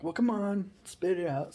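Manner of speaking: egging on. Retold or imitated. Guys urge him to tell.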